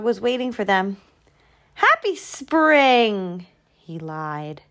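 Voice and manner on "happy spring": high, drawn-out festive call; slow, high-pitched hurrah of a greeting; cheery greeting. A narrator's reading. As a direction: sing out a holiday greeting. Groundhog greets the gathering animals.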